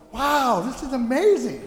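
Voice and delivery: high-pitched wonder; retold amazement. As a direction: replay being bowled over. Retelling amazement during the mass.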